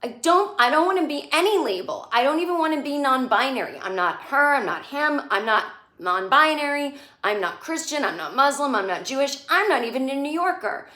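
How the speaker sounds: a hint of a stubborn thirteen-year-old; teenage kid. Rock refusing every label to Charlotte, lightly voiced sulky teen. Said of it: "And Rock's like".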